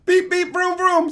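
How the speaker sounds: high toy-car beep and vroom; playful high-pitched honk and engine; imitated car noises. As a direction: squeak out little car sounds. Imitates car noises at wheel spin.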